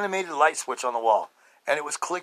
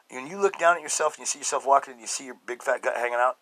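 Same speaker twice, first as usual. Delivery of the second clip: ordinary talk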